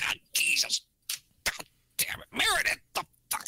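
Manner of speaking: mock angry curse, whispered, rising sharply at the end; whispered yell. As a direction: hiss the curses as a silent scream. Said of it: "They can't even yell about it".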